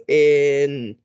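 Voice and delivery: "in" drawn right out; slow stretched word. Shown as a demonstration. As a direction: stretch each sound of "in" slowly. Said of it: "say it slow to me".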